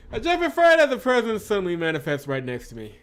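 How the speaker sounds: loud, high burst of fright; jump-scare yelp carried into narration; startled. A narrator's reading. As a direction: narrate it jolted, high and loud, settling as it goes. Startled story moment.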